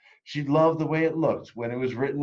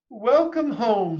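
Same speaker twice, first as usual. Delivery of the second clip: high, louder, slow and loving; mother's welcome; 13 semitones higher, 6 dB louder, slower than usual. Acted. Mother welcoming her daughter home, high and slow. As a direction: greet her like a doting mother. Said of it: "said her mother".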